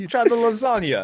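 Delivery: high, loud cartoon Italian; bad Italian accent. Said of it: "I can't do a good Italian accent".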